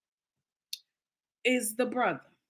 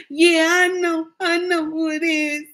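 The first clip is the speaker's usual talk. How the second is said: dumb-sounding confession; 3 semitones higher than usual. Retold or imitated. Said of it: "Just like that".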